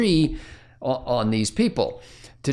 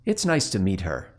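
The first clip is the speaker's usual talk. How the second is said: lower, softer inner voice, leering; inner thought voice; 4 semitones lower, 4 dB quieter than usual. Retold or imitated. Joe's inner voice in the skit, lower and leering. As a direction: let the hidden thought slip out quietly. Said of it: "you hear this voice go".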